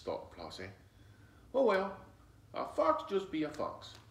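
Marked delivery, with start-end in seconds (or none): 1.5-4.0 put-on higher, brave-sounding child; little girl voice. Flossie reassuring herself in the woods, high and light. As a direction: shrug it off like a bold little girl.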